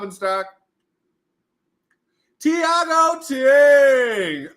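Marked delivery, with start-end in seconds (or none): 2.4-4.5 high, loud, very slow name call; announcer shout. Pretend. Streamer calling a viewer's name like a ring announcer.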